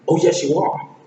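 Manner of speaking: high, punchy comeback; Donkey. Acted. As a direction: insist right back, loudly. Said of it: "Donkey goes back and says".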